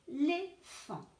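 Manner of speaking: Éléphant drawn out slowly; slow teacher reading of Éléphant; slow syllables. Shown as a demonstration. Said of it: "couper le mot".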